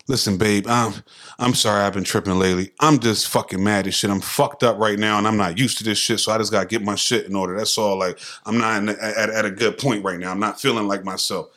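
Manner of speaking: low, soft calm explanation to his wife; level with her gently; calm sincere talk. Retold or imitated. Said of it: "but it's a different way".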